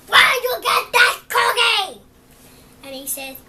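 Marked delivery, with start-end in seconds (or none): 0.1-2.0 loud, high retold yell at the duckling; pigeon yelling. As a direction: shout it like the angry pigeon. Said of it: "he said".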